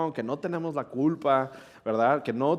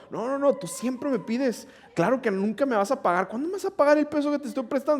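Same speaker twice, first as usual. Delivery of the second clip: high mock complaint, rising toward the end; mock whiny girl; 10 semitones higher than usual. Classmate nagging him about unpaid pesos, high whiny complaint. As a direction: play the nagging classmate, voice climbing higher.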